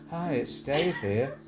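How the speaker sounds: mocking his own nervous first stream; mocking nervous newbie. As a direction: shuffle through it like a nervous first video. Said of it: "And I started off something like".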